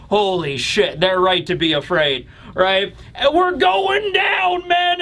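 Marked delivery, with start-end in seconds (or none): 3.1-5.0 high, drawn-out cry of alarm; mock doomed captain. Giant captain warning crew as ship falls, hammed-up wail. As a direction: stretch it out like a sinking ship's captain.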